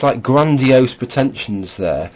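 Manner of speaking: ordinary talk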